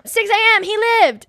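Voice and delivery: high, loud cheer; reading an all-caps note, cheering; all caps excitement. Retold or imitated. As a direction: cheer it like a win. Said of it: "All caps".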